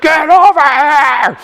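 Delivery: high, loud, long-drawn yell; exaggerated frantic scream. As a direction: holler it slowly at the top of your voice. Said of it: "screaming at me".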